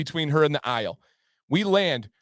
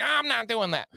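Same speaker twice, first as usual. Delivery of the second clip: higher, whiny refusal without extra volume; mock refusal; 7 semitones higher, 3 dB quieter than usual. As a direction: imitate a wife digging in. Wife's refusal acted in story.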